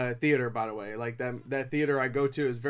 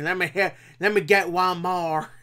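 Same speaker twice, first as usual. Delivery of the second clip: put-on Andrew Garfield's Hacksaw Ridge accent; 2 semitones higher, 4 dB louder than usual. Reviewer imitating a war-film line, mock Southern accent. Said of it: "what's his name from Hacksaw Ridge".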